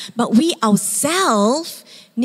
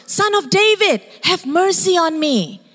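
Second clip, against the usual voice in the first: desperate plea; pleading shout; 7 semitones higher than usual. Retold or imitated. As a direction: call out to Jesus from the roadside. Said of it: "made him want to shout".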